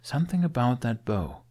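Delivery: usual speaking voice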